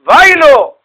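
loud, high wail of woe; drawn-out shouted lament; angel of death crying out. Acted. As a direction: cry it out like a dire warning. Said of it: "מלאך המוות צועק".

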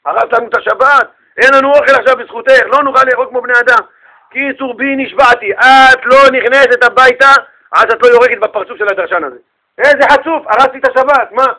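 loud, high, indignant rant; acted furious tirade; angry husband. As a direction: lay down an angry vow to your wife. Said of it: "והבעל התרגז".

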